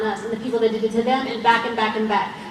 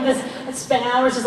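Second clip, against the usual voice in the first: normal talking voice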